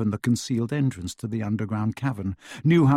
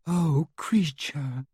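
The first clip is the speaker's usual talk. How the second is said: slow, higher, tearful wail; wailing Hermione; 4 semitones higher, much slower than usual. A narrator's reading. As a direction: draw it out in pitying tears. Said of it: "wailed Hermione, who was crying".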